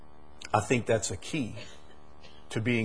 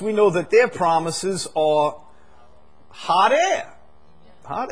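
higher, louder sarcastic jab at politicians; sarcastic preacher aside; mock preaching; 9 semitones higher, 4 dB louder than usual. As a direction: poke fun at the hot air. Mocking political promises.

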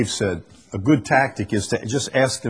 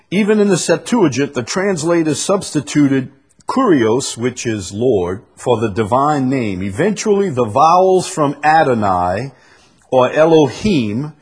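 higher, emphatic read-aloud; 5 semitones higher, 6 dB louder than usual. Acted. Preacher reading about the Septuagint translators, emphatic read-aloud. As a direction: read it out with punched key words.

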